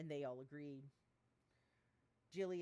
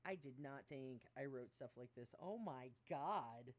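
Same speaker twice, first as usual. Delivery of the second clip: usual speaking voice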